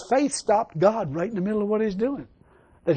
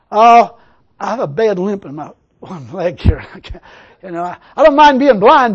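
mock pleading answer; 11 dB louder than usual. Blind man answers Jesus.

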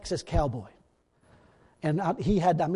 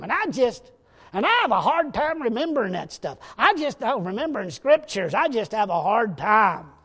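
louder, higher-pitched grumbling, hammed up; mock complaint; 3 semitones higher, 6 dB louder than usual. Preacher mimicking believers who confess forgetfulness, mock complaining. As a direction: imitate a believer making excuses, loud and overdone.

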